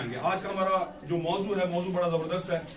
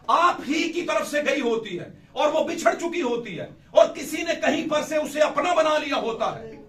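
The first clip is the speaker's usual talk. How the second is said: fervent preaching; 5 semitones higher, 3 dB louder than usual. Acted. Preaching with rising force.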